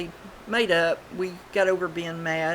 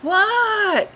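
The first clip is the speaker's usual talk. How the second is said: high, loud shouted what; hollered answer; 8 dB louder than usual. Retold or imitated. Answering the call from the front door, loud high holler. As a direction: yell back from the front door. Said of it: "and I'd say".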